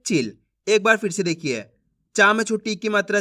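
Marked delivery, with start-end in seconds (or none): 0.1-0.4 चिल cut short on the i, low pitch; brief-vowel चिल, as the correct reading. Shown as a demonstration. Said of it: "कैसे पढ़ेंगे?".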